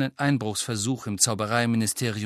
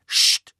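lautes, scharfes Scht zum Schweigen; scharfes Zischen; 8 dB louder than usual. A narrator's reading. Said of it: "machten die anderen beiden". (lautes Scht zum Ruhigsein)

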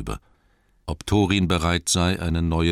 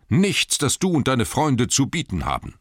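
high, forceful scorn; scornful refusal; 9 semitones higher, 4 dB louder than usual. A narrator's reading. Thorin refuses Bard and his friends, scornful. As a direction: reject the offer loudly and indignantly.

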